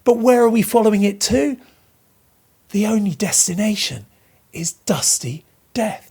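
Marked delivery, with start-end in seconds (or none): 4.5-6.1 whispered "dusty death" with the D's landing heavily; hushed whisper. Shown as a demonstration. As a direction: breathe "is dusty death" like a secret. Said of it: "heavy thudding alliteration of the D's".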